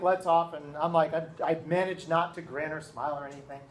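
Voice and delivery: ordinary delivery, nothing marked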